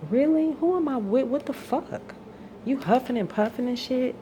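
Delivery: high, loud indignant outburst; can't believe what she's hearing; outraged disbelief. Real, not acted. Ranting to the camera about her partner, outraged and loud.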